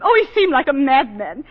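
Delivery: normal talking voice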